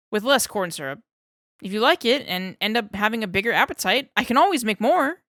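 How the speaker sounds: talking himself into a hole; eager pitch. Acted. Darling keeps pitching.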